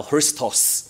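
Christos said slowly as the original Greek word; original pronunciation. Shown as a demonstration. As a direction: draw out Christos, both syllables sounded. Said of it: "它本来的音是这样读的".

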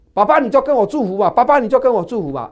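high, loud, fast pleading to father; pleading cry. Acted. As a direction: beg the father for a blessing, half crying. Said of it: "就放声痛哭".

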